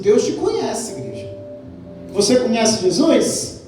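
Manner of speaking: usual speaking voice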